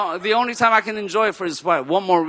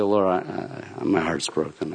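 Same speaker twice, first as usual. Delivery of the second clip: much softer, low, tearful prayer; broken-hearted prayer; 12 semitones lower, 9 dB quieter than usual. Retold or imitated. Praying alone in his room after the breakup, heartbroken. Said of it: "I say".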